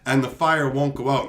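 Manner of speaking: usual speaking voice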